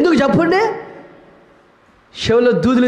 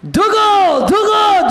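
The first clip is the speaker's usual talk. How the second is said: mock calling out; 10 semitones higher, 3 dB louder, much slower than usual. Mock nagging to comb.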